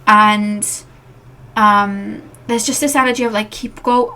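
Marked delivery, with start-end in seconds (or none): none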